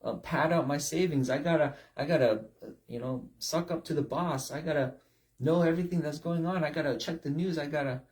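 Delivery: fast, pressured mock worrier; frantic gotta list. Preacher mimics a burnt-out striver's racing thoughts. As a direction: pile on the worries breathlessly. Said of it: "you got to".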